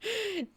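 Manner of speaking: high breathy giggle breaking out; real burst of laughter. Real, not acted. Reader loses it mid-chapter, real giggle. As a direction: crack up at your own story.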